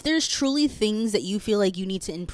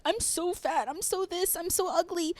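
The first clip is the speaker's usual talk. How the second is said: higher, softer whiny self-talk, mocking her teen self; mock insecure younger self; 11 semitones higher, 4 dB quieter than usual. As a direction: imitate a self-pitying girl, pitched up and small. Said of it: "when I was younger and I was like".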